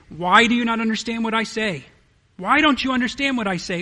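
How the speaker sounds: scolding, fed-up question; frustrated. Shown as a demonstration. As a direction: ask it like you're pointing a finger. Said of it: "I read it out of frustration almost".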